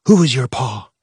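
stern mock interrogation; mother playing a Khmer Rouge soldier; angry soldier voice. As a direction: bark it like a soldier. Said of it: "She put on an angry voice".

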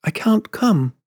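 high, sorry refusal; gently regretful high voice; apologetic. A narrator's reading. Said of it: "she said apologetically".

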